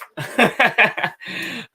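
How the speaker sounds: loud, high burst of laughter. Real, not acted. Artist amused at clapping for self, loud laugh. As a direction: laugh at your own silliness.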